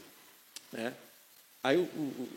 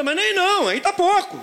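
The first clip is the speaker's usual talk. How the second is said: exasperated father; 6 dB louder than usual. Retold or imitated. Pai corrige o filho.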